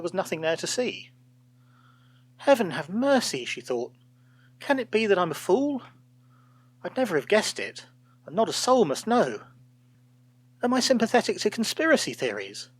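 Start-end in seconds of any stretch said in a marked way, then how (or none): 2.4-3.4 dismayed gasp. A narrator's reading. Colleague's dismayed thought. 4.6-12.7 high, fretful self-doubt; anxious. A narrator's reading. Old colleague doubting herself at the desk, high and fretful.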